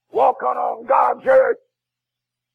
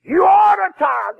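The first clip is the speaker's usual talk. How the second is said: "ought" hit higher and louder, then falling; emphasis on ought; 6 semitones higher, 3 dB louder than usual. Shown as a demonstration. Preacher pressing the congregation to tithe, shouting "ought". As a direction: punch "ought" in "You ought to tithe". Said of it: "The emphasis on ought to tithe".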